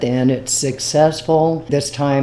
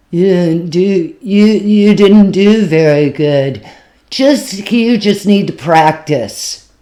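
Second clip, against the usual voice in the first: put-on slow, loud, higher drunk voice; imitating the drunk guy; 5 semitones higher, 6 dB louder, slower than usual. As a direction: lay on the sloppy drunk fan, loud and slow. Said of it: "He is super drunk".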